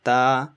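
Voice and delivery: Ta with a light puff. Shown as a demonstration. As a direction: say Ta crisply. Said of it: "Again, look here".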